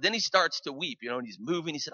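ordinary talk

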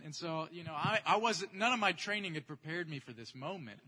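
usual speaking voice

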